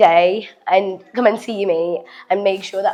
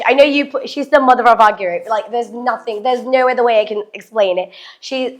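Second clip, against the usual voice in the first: usual speaking voice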